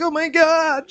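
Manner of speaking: high, loud frightened cry; mock panic. Imagining being pranked in the woods, crying out in panic. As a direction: exclaim in hammed-up terror. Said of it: "shit my pants and run away".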